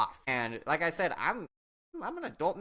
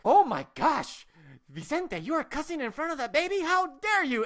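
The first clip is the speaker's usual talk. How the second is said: high, dramatic mock outrage; imitated horrified auntie; scandalized aunt; 11 semitones higher, 5 dB louder than usual. Shocked aunt scolds him.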